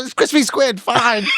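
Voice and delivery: high-pitched mock exasperation; exasperated giving in. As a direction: cave in comically, voice shooting up. Imagined cook finally gives in.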